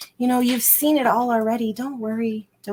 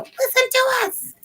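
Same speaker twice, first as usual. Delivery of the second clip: high, squeaky inner voice; little inner voice. Pretend. Reader voices the little inner voice, high and pleading. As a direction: voice the tiny voice inside pleading. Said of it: "little voice inside of us just screaming".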